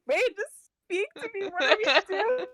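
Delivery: hammed-up drunken blubbering; drunk sobbing. Drunk Pandora sobs to Rain for help. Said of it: "She starts sobbing".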